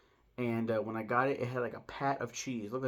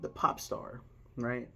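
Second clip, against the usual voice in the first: ordinary delivery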